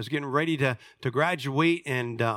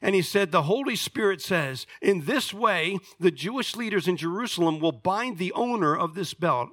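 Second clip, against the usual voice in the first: projected, higher-pitched sermon reading; raised, emphatic pulpit reading; Scripture reading; 5 semitones higher than usual. A narrator's reading. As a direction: read the passage out strongly to the congregation. Reads a Bible passage aloud.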